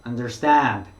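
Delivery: understand with the stress on the last syllable, stand, drawn out. Shown as a demonstration. Teacher models understand with the third syllable stressed. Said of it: "뒤에 삼 음절 쪽에 강조를".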